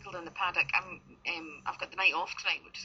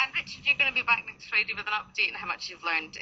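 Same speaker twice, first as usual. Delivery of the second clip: ordinary talk, nothing marked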